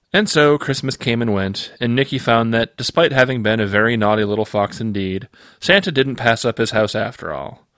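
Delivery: usual speaking voice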